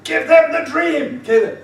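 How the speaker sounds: loud, high, drawn-out urging; imitated shout. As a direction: call out insistently over someone's speech, stretching the words. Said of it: "She's shouting at him".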